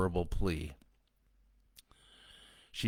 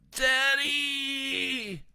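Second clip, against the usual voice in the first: put-on high, loud drawn-out child's scream; boy screaming for his father while attacked; 5 dB louder than usual. A narrator's reading. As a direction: shriek for your father. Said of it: "Greg screamed".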